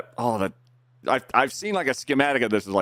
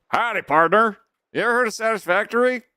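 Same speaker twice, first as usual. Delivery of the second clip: fake cowboy accent; 3 dB louder than usual. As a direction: greet them like a playful cowboy. Greets raider with a cowboy greeting.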